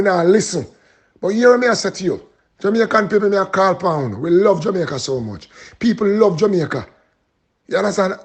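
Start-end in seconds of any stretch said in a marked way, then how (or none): none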